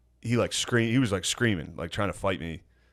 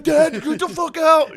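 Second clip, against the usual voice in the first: high, loud, drawn-out yell; hammed-up shriek, high and loud; mock yell; 7 dB louder, slower than usual. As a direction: scream him out the door. Yelling at dad in room.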